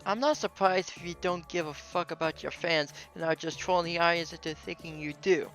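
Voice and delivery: ordinary talk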